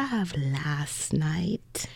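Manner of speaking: slow, unvoiced whisper. Real, not acted. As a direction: whisper the shameful part slowly. Shameful detail, lowered voice.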